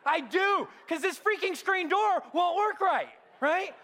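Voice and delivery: high, loud exasperated shout; retold angry reply. Preacher replaying his angry answer to God, high exasperated shout. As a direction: yell it like the door broke you again.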